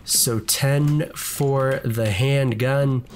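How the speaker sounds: ordinary talk, nothing marked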